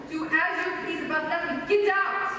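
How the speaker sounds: angry dismissal. Acted. Madeleine ordering Bert out of the house, building to a shout. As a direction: throw her out, anger building.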